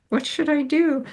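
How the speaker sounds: mock helplessness. Student given free rein to sing, mock helpless. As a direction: pitch it up, playfully at a loss. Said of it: "And I'm like".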